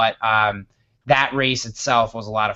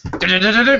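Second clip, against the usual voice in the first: high, quick "du-du" noise; imitated music restarting; 7 semitones higher, 4 dB louder than usual. Diner music resumes after the answer, high imitated music. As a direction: rattle out the music kicking back in. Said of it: "music starts back up".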